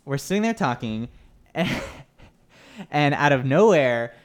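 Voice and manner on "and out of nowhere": ordinary talk, nothing marked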